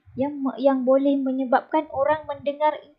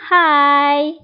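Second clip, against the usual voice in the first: higher, louder hai; calling hai; 4 semitones higher, 6 dB louder than usual. Shown as a demonstration. Teacher demonstrating a greeting hai. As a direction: call for attention. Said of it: "hai digunakan untuk menarik perhatian".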